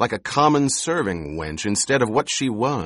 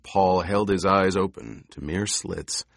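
plain reading voice

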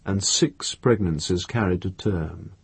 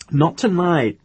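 higher, curt brush-off; irritated refusal; 9 semitones higher, 3 dB louder, slower than usual. A narrator's reading. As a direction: narrator voicing an annoyed refusal. Refusing while slamming a drawer.

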